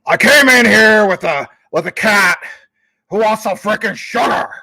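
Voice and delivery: loud, high-pitched growling character voice; strained, shouted impression of a film character; movie impersonation. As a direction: belt it out as an over-the-top impression. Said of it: "doing that impersonation".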